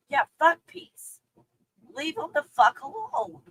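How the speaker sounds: mock dismissive aside; sarcastic retort. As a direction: toss it off with scorn. Sarcastic reply.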